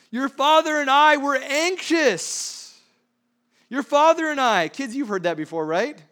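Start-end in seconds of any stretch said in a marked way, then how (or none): 0.1-4.7 high, loud, slow scold; emphatic scolding mother. Acted. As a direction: lay down a mother's rebuke. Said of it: "Her second question is so emphatic".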